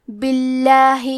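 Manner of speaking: باللہ with a thin, light lam after the kasra. Shown as a demonstration. Teacher models the thin lam in باللہ. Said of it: "حرف اللام کو پتلی آواز میں پڑھیں گے".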